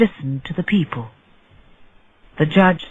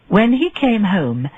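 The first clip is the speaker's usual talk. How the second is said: plain reading voice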